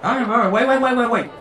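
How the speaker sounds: high, rapid-fire string of waits; hurried backtracking. Acted. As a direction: call a halt in a rush, pitch up. Preacher hurriedly halts the eviction.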